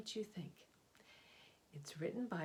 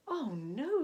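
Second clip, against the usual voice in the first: a hint of a horrified gasp, higher and louder; shocked serpent; 8 semitones higher, 10 dB louder than usual. Gentle serpent refusing the shark, mock-horrified. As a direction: recoil at the idea. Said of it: "said Cyrus".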